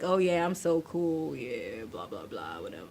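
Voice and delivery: low, lazy mock cool-guy voice; mock a guy who thinks he's cool, low and quiet; dropping off into a dismissive mumble; too-cool guy. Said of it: "guys that are like".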